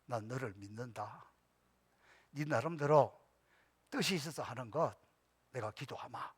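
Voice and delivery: soft, low trusting words; gentle reassurance. Retold or imitated. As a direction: speak to the son softly, with faith. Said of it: "기도하는 마음으로 들어주세요". (아들에게 부드럽고 낮게 믿음을 전하는 말투)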